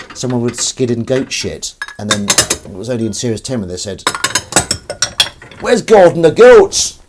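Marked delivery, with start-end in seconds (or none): 5.6-7.0 loud, high northern English voice; northern English accent. Acted. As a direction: act a northern English school character calling out. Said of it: "I don't know why they're northern in Grange Hill".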